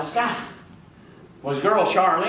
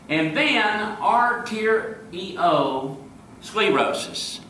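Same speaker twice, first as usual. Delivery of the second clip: drawn out; 4 semitones higher, much slower than usual. Acted. Long list of ailments, drawn out.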